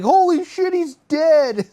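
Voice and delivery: high, loud alarmed cry; hammed-up horrified yell; mock panic. Family has just run over Bigfoot, mock panicked yell.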